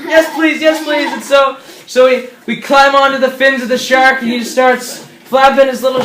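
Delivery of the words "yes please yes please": high, eager acceptance; eager pleading. Acted. Castaways accepting the shark's offer of a ride, eager pleading. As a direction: jump at the offer in a raised voice.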